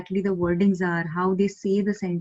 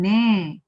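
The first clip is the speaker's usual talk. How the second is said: "ne" drawn out and gliding down; falling accent; 5 dB louder than usual. Shown as a demonstration. Teacher demonstrating agreement, falling on ne. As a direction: let a long "ne" fall in pitch. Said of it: "you say a falling accent".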